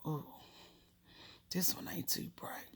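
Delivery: whispered aside, very soft; mother's unspoken verdict. Retold or imitated. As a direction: voice a mother's private thought under your breath. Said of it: "She looked at me like".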